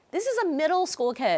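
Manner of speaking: high-pitched retold amazement; amazed. Recalling her awe at a young mask-maker, amazed. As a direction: marvel at it, pitch lifted right up. Amazed reaction to a moving story.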